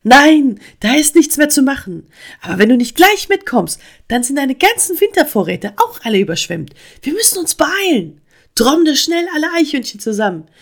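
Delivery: high, loud agitated frog; rally help with urgency; drängend. A narrator's reading. Frog urges help against flood. (aufgeregt und laut zur Eile drängen)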